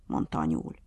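plain reading voice

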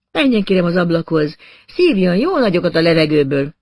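a pushy woman cooing him toward the window; coaxing woman. Acted.

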